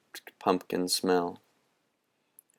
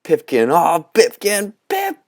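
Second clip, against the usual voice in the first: much higher, louder ragged cheer; shout of triumph; 10 semitones higher, 6 dB louder than usual. A narrator's reading. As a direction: let it all out in relief. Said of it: "a ragged shout of triumph".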